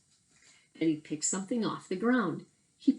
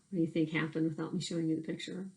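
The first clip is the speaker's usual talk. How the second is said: ordinary delivery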